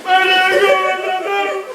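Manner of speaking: very high, slow, hammed-up whimpering; the pastor begs Raulito for help, mock weeping; mock crying plea. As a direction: drag out the plea like a crybaby. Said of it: "Tal vez los dos hubiéramos estado llorando".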